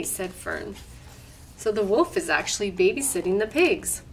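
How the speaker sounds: ordinary delivery, nothing marked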